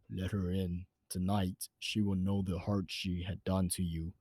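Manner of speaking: low, soft, eerie voice in his head; an uncanny whisper from inside the mind; inner voice. A narrator's reading. Voice in his head urging him to let her in, low and eerie. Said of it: "a voice inside my head spoke to me".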